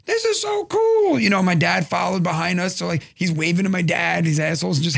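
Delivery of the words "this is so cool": put-on very high, drawn-out awed kid; little son. Son riding in the ambulance, high thrilled kid voice. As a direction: imitate a thrilled small boy, slow and pitched up. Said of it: "and he's like".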